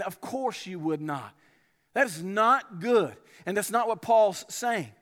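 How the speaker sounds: ordinary delivery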